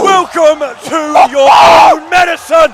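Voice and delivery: shouting. Real, not acted. Caster hype call.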